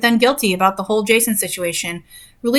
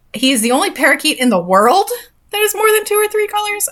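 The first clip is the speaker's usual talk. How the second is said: rising higher in disbelief; incredulous; 10 semitones higher than usual. Real, not acted. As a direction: reread it like you can't believe it. Disbelieving reread.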